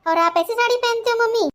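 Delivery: put-on loud, hurt complaint from a little girl; indignant child. Girl to mother about the aunts, loud and hurt. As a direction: let the hurt come out loud.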